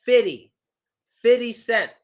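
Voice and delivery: "fifty" said like "fiddy"; dropped second F. Shown as a demonstration. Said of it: "We pronounce it".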